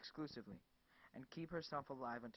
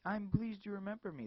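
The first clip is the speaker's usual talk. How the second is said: smiling voice; 8 semitones higher, 8 dB louder than usual. Acted. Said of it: "as if it was smiling".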